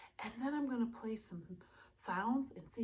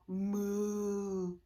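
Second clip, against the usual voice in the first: mooing cow; 2 semitones lower than usual. Shown as a demonstration. Teacher mooing like a cow for kids. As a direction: moo like a cow.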